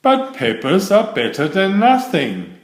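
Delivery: a hint of a cheeky friend; lightly voiced Warthog. A narrator's reading. Warthog answering Jim, lightly teasing.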